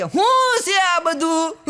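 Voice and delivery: high, slow, drawn-out puzzled query; Jethalal-style voice. Retold or imitated. Neighbour running in at the noise, slow high comic bafflement. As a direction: stretch it out in a comic TV-sitcom voice. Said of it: "Jethalal જેવો એક ભાઈ".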